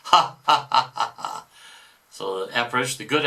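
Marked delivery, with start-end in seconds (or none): 0.1-1.4 mock evil cackle; devil's laugh. Devil gloating over heretics, hammed-up cackle.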